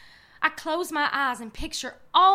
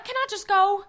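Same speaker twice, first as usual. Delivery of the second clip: usual speaking voice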